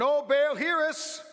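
high, loud cry to Baal; pleading cry. Retold or imitated. Prophets of Baal begging Baal to answer, loud high cry. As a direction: call out to the idol, pleading and high.

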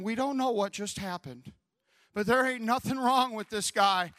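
building, loud amazement; the doctor can't believe it; amazed doctor. Retold or imitated. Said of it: "this doctor is saying".

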